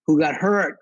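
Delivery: usual speaking voice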